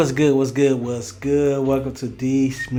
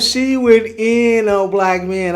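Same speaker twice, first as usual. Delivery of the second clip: higher, louder hyped reaction; excited reaction; 7 semitones higher, 4 dB louder than usual. Real, not acted. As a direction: hype up the clip you just played. Host reacting to the clip.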